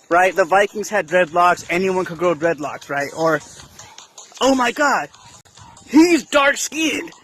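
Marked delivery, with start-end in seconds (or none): 4.4-7.1 mock-shocked shout, much higher, slow; scandalised gossip rising to a shout; mock outrage. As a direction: ham up a scandalised bystander. Mocking people who stereotype.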